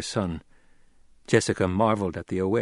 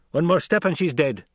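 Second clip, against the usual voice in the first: high, loud warning; snapped threat; 6 semitones higher, 5 dB louder than usual. A narrator's reading. Captor warning the son off, snapping. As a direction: threaten the hostage's life. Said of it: "Gurney snapped".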